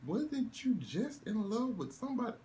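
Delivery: high incredulous question; squeaky puzzled query; baffled disbelief. Retold or imitated. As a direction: ask it like it makes no sense at all. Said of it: "I'm like".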